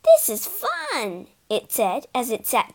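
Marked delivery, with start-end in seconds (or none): 0.0-1.3 high, drawn-out delight; slow, high-pitched enjoyment. A narrator's reading. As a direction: stretch it out with high glee. Ghost enjoys the ride.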